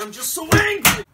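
sudden loud angry burst; angry outburst. Acted. Son bursting out at his dad in anger. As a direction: blurt it out furious.

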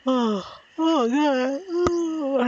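talking through a yawn; words stretched by a yawn. Real, not acted. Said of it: "I yawned".

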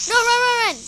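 loud, high, rapid-fire warning; urgent shout. Real, not acted. Player warning teammate to run from creeper, urgent shout. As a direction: yell it as a real alarm.

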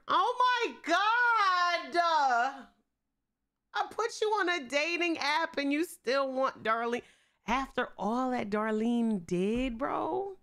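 real frustrated wail at a stubborn Sim; exasperated outcry. Real, not acted. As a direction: squeal in outraged disbelief. Exasperated at her Sim's choice.

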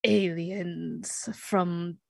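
slow, halting, pompous proclamation; would-be leader fumbling a grand speech; self-appointed leader. Acted. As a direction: address them grandly, word by word. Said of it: "presents himself as the leader of the group".

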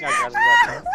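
high laughing shout, panting; heavy breathing. Pretend. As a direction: pant and shout through laughter. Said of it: "If you want heavy breathing".

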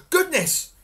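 high, loud exclamation; alarmed cry. A narrator's reading. Giantess hearing her husband approach, crying out. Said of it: "cried the giantess".

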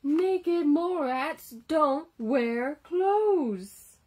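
loud, slow, every word spelled out; slow and firm. Acted. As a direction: lay down the rule slowly. Rats lecture Wilbur firmly.

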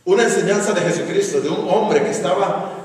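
ordinary talk

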